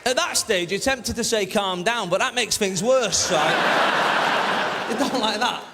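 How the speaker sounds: loud, slowed-down building rant. Real, not acted. Comedian telling the crowd about Liverpool, loud rant. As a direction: push it out loud to the back row. Excited shouted storytelling.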